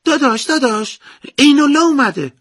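put-on high excited child voice, loud; little brother voice. A narrator's reading. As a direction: call out like an excited little boy. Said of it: "برادر کوچیکم پرید تو اتاق و گفت".

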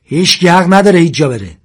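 loud high angry yell; shouting. Acted. Sasan with the gun yells an order at the men, shouting. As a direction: bellow the order at everyone. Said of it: "ساسان داد زد".

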